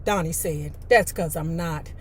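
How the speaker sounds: low, unenthusiastic reply; unexcited. A narrator's reading. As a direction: answer low, with no excitement at all. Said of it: "You don't sound excited".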